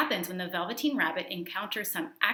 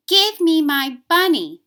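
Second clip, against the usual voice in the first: put-on higher, louder, slow child's voice; pleading little boy; 9 semitones higher, 14 dB louder, slower than usual. A narrator's reading. The boy in bed reaches for his bunny, high childlike plea. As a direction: demand it like an upset small boy. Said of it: "he said".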